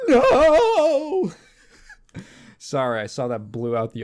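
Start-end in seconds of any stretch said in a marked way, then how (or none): 0.1-1.3 much higher, louder hammed-up anguished scream; mock scream to the sky. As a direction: howl it at the heavens, half laughing. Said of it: "just screaming to the sky".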